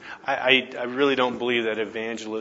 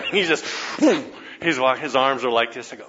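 usual speaking voice

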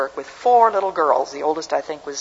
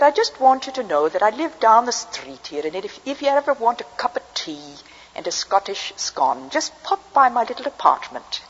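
put-on Scottish accent; 7 semitones higher than usual. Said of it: "She had a Scottish accent".

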